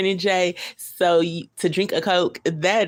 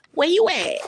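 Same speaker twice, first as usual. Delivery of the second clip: much higher, louder Boost Mobile chirp voice; imitating the Boost Mobile ringtone, pitched way up; ringtone imitation; 13 semitones higher, 6 dB louder than usual. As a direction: mimic the old phone ringtone. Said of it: "one of the ringtones was".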